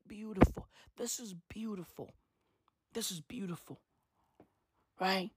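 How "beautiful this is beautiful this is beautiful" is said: much softer, hushed awe. Retold or imitated. Gloating over the fire images in a hushed, soft voice. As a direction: murmur it as if admiring the fire. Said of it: "I was like".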